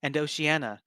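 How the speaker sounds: usual speaking voice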